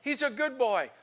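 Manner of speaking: high, slow, pleading mock yell; retold yelling. Son pleading for his friend, imitated yelling. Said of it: "yelling at his dad".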